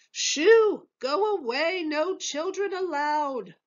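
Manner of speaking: high, loud shoo, then slow; shooing shout. A narrator's reading. Head waiter shooing the twins away, high and loud. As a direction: chase the kids out, stretching the words.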